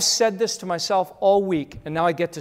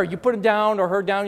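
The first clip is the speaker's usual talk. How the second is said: ordinary talk, nothing marked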